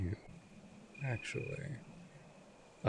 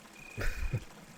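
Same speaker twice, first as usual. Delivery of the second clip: brief, voiceless laugh; a dismissive little huff of laughter; short chuckle. Acted. Vampire amused at the captive's embarrassment, short chuckle.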